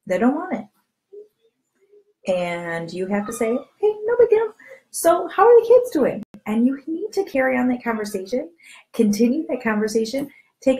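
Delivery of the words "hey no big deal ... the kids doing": much higher and louder, bright and breezy; sunny, unbothered change of subject; cheerful no big deal. Acted. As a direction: brush off the no and chirp on to the kids. Cheerfully moving past a no.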